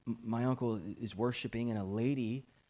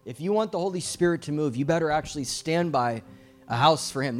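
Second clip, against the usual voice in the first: loud, driving exhortation; forceful urgent mentor; urgent loud charge; 3 semitones higher, 8 dB louder than usual. Retold or imitated. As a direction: drive it home like a mentor who won't take no. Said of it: "And he said".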